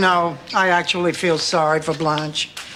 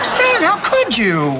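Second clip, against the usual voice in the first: shocked reproach; 10 semitones higher, 4 dB louder, slower than usual. Acted. Wife confronts cheating ex-husband.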